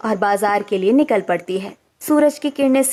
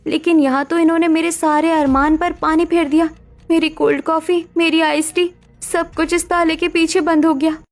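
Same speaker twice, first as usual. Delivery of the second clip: put-on higher, girlish young bride, whining in dismay; higher dismayed young woman's voice; young daughter-in-law lament; 6 semitones higher than usual. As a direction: pout over the lost cold coffee. Ruhi laments locked fridge.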